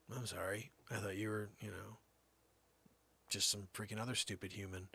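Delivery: soft whispered apology; hushed dog apology. Retold or imitated. Dog's inner voice apologizing.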